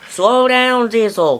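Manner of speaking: high, loud cry to slow down. A narrator's reading. Clarabelle cries out to Diesel to slow down, high and loud. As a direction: call out high and loud, pleading. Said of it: "cried Clarabelle".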